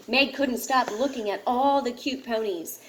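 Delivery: ordinary delivery, nothing marked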